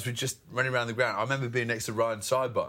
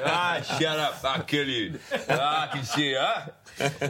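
mild mock fury, slightly raised; defcon-five blow-up; slower than usual. Player imitates teammate answering the crowd, hammed-up rage. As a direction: imitate a hothead snapping back at hecklers. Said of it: "defcon five".

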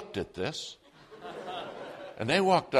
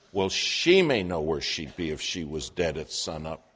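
solemn stranger; 6 semitones lower than usual. Retold or imitated. Woman with milk jugs confessing to the preacher, low grave voice. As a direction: speak it heavy and low like a warning. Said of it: "I like to come out of my shoes".

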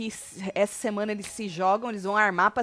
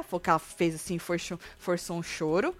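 ordinary talk, nothing marked

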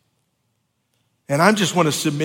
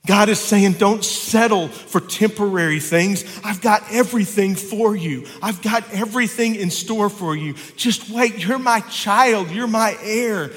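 full-force preaching; 6 semitones higher than usual. Acted. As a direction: preach it like a revival.

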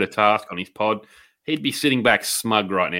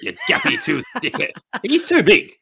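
mocking squeal. Mocking Chisora with a laugh.

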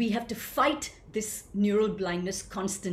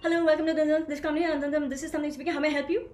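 higher, louder and faster mumbled agent patter; hammed-up call-centre agent gabbling the greeting; rushed helpline greeting; 7 semitones higher, 4 dB louder, much faster than usual. Shown as a demonstration. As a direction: swallow the words as you race through the script. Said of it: "you've missed the whole thing".

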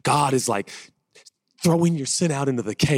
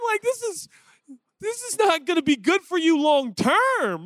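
talking to a puppy. Retold or imitated. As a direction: coo at the puppy to calm down.

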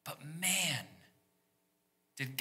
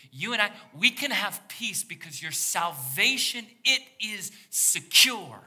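rising fervor; 7 semitones higher, 8 dB louder than usual. Real, not acted. Preaching climax on salvation.

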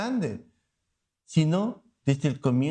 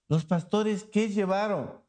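ordinary delivery

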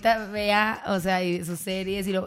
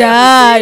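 loud mock explosion "Da"; mock blast sound; 17 dB louder than usual. Telling friends about a superpower battle, loud mock blast.

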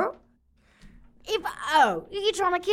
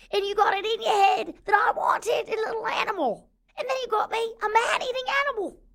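rising, higher exasperated rant; higher indignant complaint; 7 semitones higher than usual. Acted. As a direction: climb higher with each outraged word. Ranting at Keith over pet.

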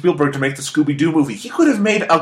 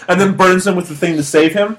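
ordinary delivery

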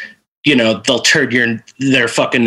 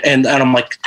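normal talking voice

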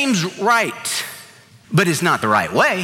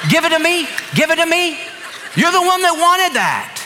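exasperated retort; 9 semitones higher than usual. Retold or imitated. Son scolding dad over juice.

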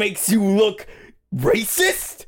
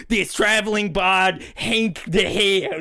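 ordinary talk, nothing marked

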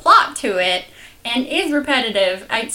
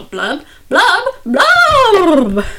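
loud rising blubs, the last one higher and louder; fish blub sounds; 8 semitones higher, 8 dB louder, much slower than usual. Shown as a demonstration. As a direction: bubble out each blub like a fish, ending big. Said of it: "I go".

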